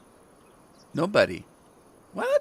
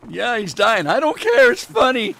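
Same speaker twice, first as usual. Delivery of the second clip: much higher and louder, a callous jeering crowd; mocking onlookers; 12 semitones higher, 8 dB louder than usual. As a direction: mimic bystanders who find his dying funny. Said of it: "everybody'd be laughing at me".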